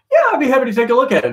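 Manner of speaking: fast, high and eager; eager retold reply. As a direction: rattle it off brightly. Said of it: "I was like".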